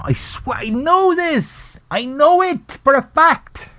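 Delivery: very high, drawn-out squeal of certainty; high-pitched insistence. Acted. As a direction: swear it in a slow, soaring high voice. Breaks off to insist he knows.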